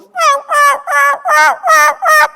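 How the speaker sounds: high loud mock meowing; high shrill-free meows, loud; cat meow calls. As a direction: meow like a lost hunter calling badly.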